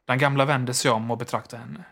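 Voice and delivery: plain reading voice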